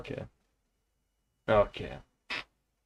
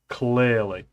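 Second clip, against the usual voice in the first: usual speaking voice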